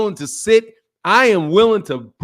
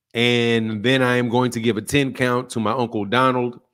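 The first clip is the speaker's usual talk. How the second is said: low, subdued grief; 8 semitones lower than usual. Real, not acted. Streamer telling chat his uncle died, low and somber. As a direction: speak low about a death in the family.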